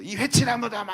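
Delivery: loud, angry retold outburst; shouting an insult. Paul yelling at the high priest, loud angry shout. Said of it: "하고 막 소리를 질러요".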